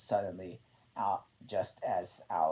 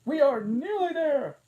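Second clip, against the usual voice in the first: panting; 13 dB louder than usual. A narrator's reading. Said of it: "she managed to pant".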